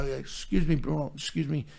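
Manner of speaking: soft, polite interruption; retold deferential murmur; very respectful. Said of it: "being very respectful".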